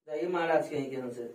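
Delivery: low, very soft grumble; grumbling aside. Real, not acted. Teacher teasing whoever set the marker wrong, in a low grumbling aside. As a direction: complain under your breath, pitch dropped low.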